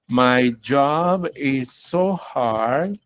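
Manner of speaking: very slow, drawn-out teacher's example; slow model sentence. Shown as a demonstration. Teacher models a corrected sentence for students, very slowly. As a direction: stretch each word, lingering on "so". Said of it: "uno puede decir algo como".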